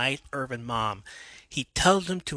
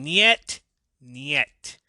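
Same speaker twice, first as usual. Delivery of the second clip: put-on shouted Russian accent; 3 semitones higher, 7 dB louder than usual. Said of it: "speaking in a Russian accent and shouting".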